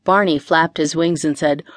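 ordinary delivery, nothing marked

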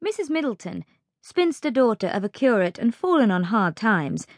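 plain reading voice

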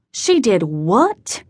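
slow, drawn-out incredulous question, swooping up at the end; incredulous father, pitch dipping then rising sharply; disbelief. A narrator's reading. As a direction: stretch it out in stunned disbelief. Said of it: "disbelief in his voice".

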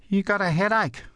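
put-on high child's voice asking; little girl. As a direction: ask Dad in a high small-girl pitch. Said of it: "The little girl".

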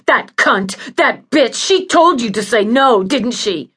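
furious patient accusing the nurse; rising angry voice. Acted. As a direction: lash out angrily, voice rising. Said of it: "Judy's voice rose".

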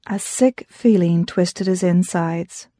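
ordinary delivery, nothing marked

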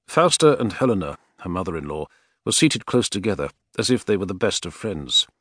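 ordinary delivery, nothing marked

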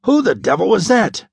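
a startled gasp of a question. Acted. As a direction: blurt it out in stunned disbelief. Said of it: "gasped the stocky mate".

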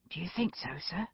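hushed, near-whispered question; small, very soft voice; subdued. A narrator's reading. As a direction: ask softly, shaken. Shaken young constable asks after outburst.

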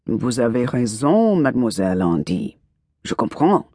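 casual French. Acted. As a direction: answer in French as if it's nothing. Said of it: "He replied as if the most natural".

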